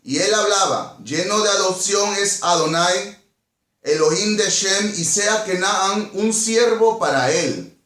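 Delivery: ordinary delivery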